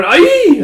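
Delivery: loud, very high-pitched cry; acted shriek; high yelp. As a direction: yelp out sharply at the top of the voice.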